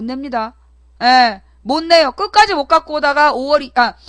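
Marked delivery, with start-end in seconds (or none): none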